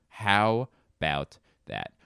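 "How about that" slow, word by word; slow, emphatic "How about that"; drawn-out repeat. Real, not acted. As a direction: stretch out HOW and let each word stand apart.

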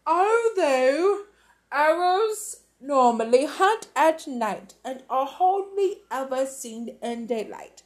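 crisp TV news read; newsreader voice. Acted. Storyteller voicing the TV newsreader's bulletin.

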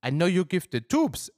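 high-pitched, radiant thanks; beaming. Acted. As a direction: gush happily with gratitude. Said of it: "erwiderte sie strahlend". (strahlend und dankbar, mit hoher Stimme)

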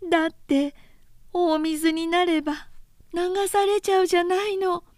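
put-on high, whiny pleading girl's voice; tearful younger sister. A narrator's reading. Oyoshi defends her bundle to Ohiro, high and tearful. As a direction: plead like a frightened child clutching her bundle.

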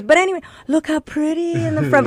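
a doting grandmother's quick recovery; grandma changing the subject. Retold or imitated. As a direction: brush it off like a polite grandma. Voicing grandma moving on.

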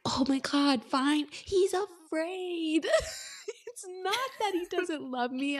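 hammed-up realisation, high-pitched and drawn out on the key word; mock a heroine frantically talking herself round; overwrought inner monologue. Reader imitating Harriet reasoning it out in her head, mock overwrought. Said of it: "go through this in her mind".

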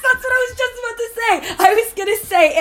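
high, loud and eager; squealing yes, that's it; excited agreement. Real, not acted. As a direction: blurt it out, thrilled someone gets it.

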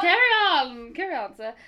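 hammed-up pompous cheer. Echoing the mock cheer.

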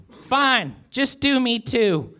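high, exasperated character voice; Goober frustrated. A narrator's reading. Goober removing his shirt in frustration, high exasperated voice. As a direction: give in high, dragging the end. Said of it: "in frustration".

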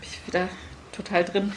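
soft, quick amused aside; laughing at herself. Real, not acted. Reader laughing quietly at herself after the reading. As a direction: chuckle it away under the breath. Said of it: "so in Rage gelesen".

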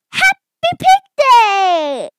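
high, loud, drawn-out cheer; jubilant announcement. Acted. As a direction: shout it out with a long final word. Piggy announces the holiday.

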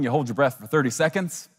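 ordinary delivery

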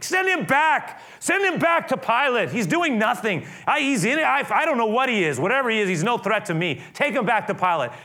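high, loud impatient orders; dismissive Herod. Acted. Herod sending Jesus back to Pilate, loud and high. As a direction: throw him out, done with him.

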